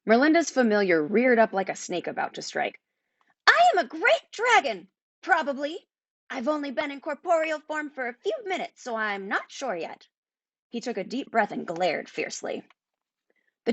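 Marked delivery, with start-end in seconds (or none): 3.5-10.1 very high, boastful creature voice that fades; proud little dragon. A narrator's reading. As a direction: puff up like a tiny dragon unsure of itself. The familiar speaks.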